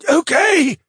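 high choked plea, falling. Acted. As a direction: gasp it out under a chokehold. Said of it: "the mage choked out".

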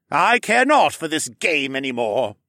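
loud, high villain voice; gloating treacherous councilman; sneering villain. A narrator's reading. Hess villain speech.

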